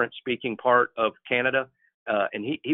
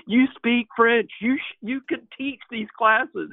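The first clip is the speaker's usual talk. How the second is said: high, loud disbelief at a friend; can't believe what he's hearing; incredulous outburst; 11 semitones higher, 4 dB louder, slower than usual. Retold or imitated. Retelling his disbelief at friend.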